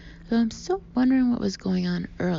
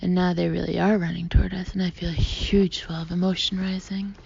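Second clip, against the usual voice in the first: plain reading voice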